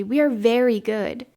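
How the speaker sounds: eager, emphatic assurance. A narrator's reading. Children assuring the girl they are good, eagerly. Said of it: "they answered eagerly".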